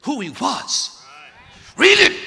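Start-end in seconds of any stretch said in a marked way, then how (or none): 1.8-2.2 high, loud preaching shout; full-force preaching. Real, not acted. Preacher driving the point home, high loud shouting. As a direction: preach it at the top of the lungs.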